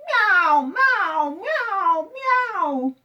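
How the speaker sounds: loud high cat meows; cat meowing. A narrator's reading. Cat at the vet meowing loudly. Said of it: "she meowed more than ever".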